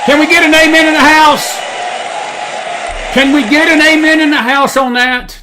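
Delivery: loud, high preacher's shout; calling for an amen. Real, not acted. Preacher to congregation after the clip, loud rousing call. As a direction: holler it out to the whole house.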